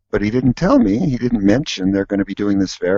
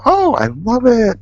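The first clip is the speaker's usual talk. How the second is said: gushing; 14 semitones higher than usual. Retold or imitated. Crew members gushing over his set.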